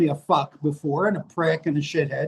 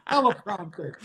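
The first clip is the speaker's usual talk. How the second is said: laughing; 2 semitones higher, 5 dB quieter than usual. Real, not acted. Laughing at the notes joke.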